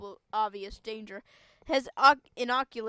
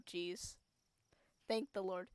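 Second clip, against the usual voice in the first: normal talking voice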